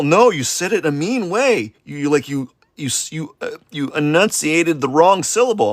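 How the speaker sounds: high-pitched, stumbling imitation of a complainer; mock accusation. Acts out accuser scolding him.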